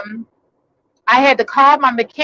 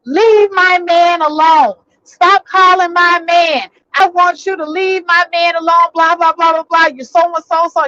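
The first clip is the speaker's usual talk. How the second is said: mock yelling; 6 semitones higher than usual. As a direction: act out her yelling down the phone. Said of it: "starts yelling into the phone".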